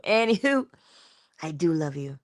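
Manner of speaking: normal talking voice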